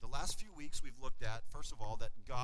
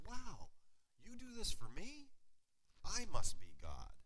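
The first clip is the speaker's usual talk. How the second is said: smug cat; 6 semitones higher, 5 dB quieter than usual. Retold or imitated. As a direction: play the vain cat who thinks it is God. Cat speaks, contrasted with dog.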